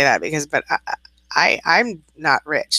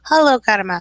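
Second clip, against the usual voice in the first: cooing at the cat; 6 semitones higher, 4 dB louder than usual. Real, not acted. Greeting her kitten.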